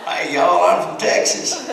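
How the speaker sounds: loud, high, drawn-out Texas accent. Shown as a demonstration. Speaker showing how a Texas accent goes, loud Texas drawl. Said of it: "do a me a Texas accent".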